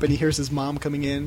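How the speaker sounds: ordinary delivery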